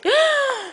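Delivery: sudden high startled gasp; sharp gasp. Real, not acted. Mother sees her son wobble, alarmed gasp. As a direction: catch your breath in alarm. Child nearly falls.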